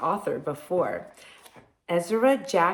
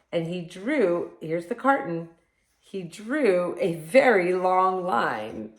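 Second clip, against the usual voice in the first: lively storybook read-aloud; reading to children; 4 dB louder than usual. Acted. Reading picture book to children.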